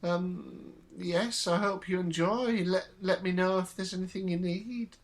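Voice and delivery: put-on high, thin old lady voice; fussy elderly landlady showing rooms; very old woman. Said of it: "June sort of comes in and goes".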